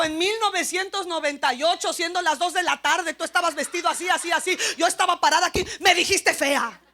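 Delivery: loud, insistent mock accusation; acted grievance. Wife reminding husband of an old insult, mock accusing. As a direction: list every remembered detail like evidence, building to the charge.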